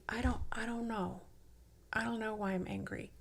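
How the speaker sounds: softly voiced, at a loss; quiet, unsure reply. Body answering her question about its anger, quiet and unsure. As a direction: admit it softly, under the question.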